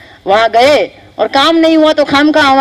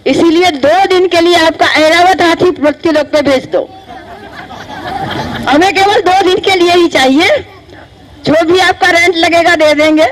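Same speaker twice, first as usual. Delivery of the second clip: casual cheeky request; 4 semitones higher than usual. Retold or imitated. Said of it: "नहीं, नहीं, ऐसा नहीं बोला".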